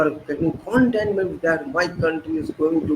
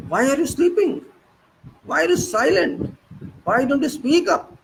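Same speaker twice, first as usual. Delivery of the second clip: indignant challenge; 8 semitones higher than usual. Real, not acted. Scolding silent people, high pitch.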